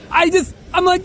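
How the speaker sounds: usual speaking voice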